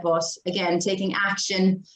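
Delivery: ordinary talk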